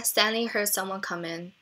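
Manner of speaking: ordinary delivery, nothing marked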